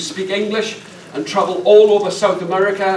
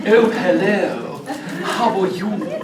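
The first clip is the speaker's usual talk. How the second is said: lightly voiced slow plummy Queen's English; a mock upper-class English hello; a hint of the Queen's English, amused; posh Queen's English; slower than usual. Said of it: "don't speak like the Queen".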